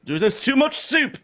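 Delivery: muffled shout from behind a door; through a wall. Acted. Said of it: "you hear a muffled voice from inside say".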